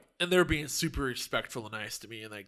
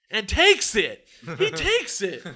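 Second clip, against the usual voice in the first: high, loud comic outrage; loud disbelief; 7 semitones higher, 10 dB louder, slower than usual. Real, not acted. Telling of the man answering mid-movie, loud outraged disbelief. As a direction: punch TAKES in indignant disbelief.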